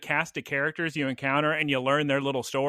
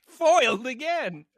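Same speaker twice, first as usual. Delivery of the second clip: slow, high hammed-up cartoon villain; mock foiled villain; 10 semitones higher, 3 dB louder, slower than usual. Joking with co-hosts, a slow, high hammed-up villain groan. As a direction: ham up a defeated cartoon baddie.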